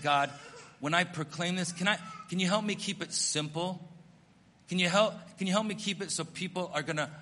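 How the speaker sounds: ordinary talk, nothing marked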